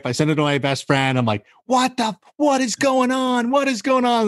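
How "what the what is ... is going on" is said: can't believe what he's reading; retelling shock at Mike Trout's message, high disbelief. Retold or imitated.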